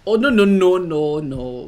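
very loud, high burst of panic, dropping off; alarmed shout. Real, not acted. Player seeing a sudden slip, alarmed shout. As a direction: shout in sudden alarm, then sink back.

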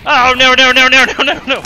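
high, loud panicked repeats. Real, not acted. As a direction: panic as it goes wrong. Panicking during a fight.

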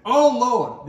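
high, loud call of Oh Lord; loud cry to God. Retold or imitated. Fisherman calling to God so the whole crew hears, loud cry. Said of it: "so everybody would hear it".

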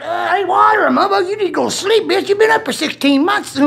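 high, fast mocking scold; mock scolding buddy. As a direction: bark it like a fed-up friend. Buddy quoted yelling at him.

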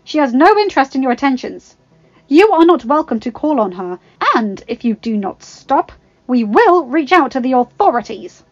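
loud, high, emphatic scolding; sharp, courageous warning. A narrator's reading. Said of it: "a new burst of courage".

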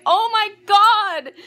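high, loud, drawn-out reenacted shriek; loud gleeful scream, stretched out; retold excited scream. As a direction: re-enact screaming with delight. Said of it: "I screamed. I'm like".

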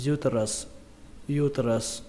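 "uterus" opening on stressed "iu"; "iu" first syllable. Shown as a demonstration. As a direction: say "uterus" as IU-tơ-rớs. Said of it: "các bạn có thể đọc là âm iu". (đọc "uterus" với âm đầu "iu")

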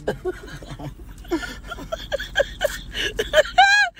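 high, cracking laugh that almost turns to crying; burst of laughter. Real, not acted. Friend laughing hard at the surprise, high and teary. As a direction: laugh out in disbelief.

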